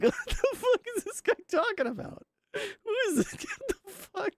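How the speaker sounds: muy agudo, incrédulo, entre risas; incredulous. Real, not acted. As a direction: reacciona con asombro chillón. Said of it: "incredulous reaction".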